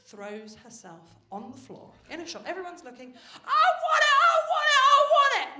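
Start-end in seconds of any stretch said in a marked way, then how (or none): 3.4-5.6 put-on much higher, louder, stretched toddler whine; toddler tantrum wail. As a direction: wail it like a furious three-year-old. Tantrum on shop floor.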